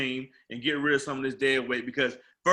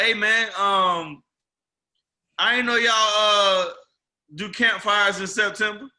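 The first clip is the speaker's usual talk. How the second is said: raised, louder voice with drawn-out hesitations; teasing customer; 4 semitones higher, 4 dB louder, slower than usual. Retold or imitated. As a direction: imitate a customer setting up a tease. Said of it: "one of my customers from New Orleans".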